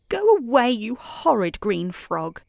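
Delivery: high, loud start easing off; a lightly acted telling-off; cross outburst. A narrator's reading. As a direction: send the pest away crossly. Crying girl tells off frog.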